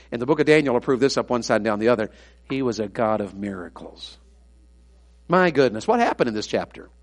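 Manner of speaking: normal talking voice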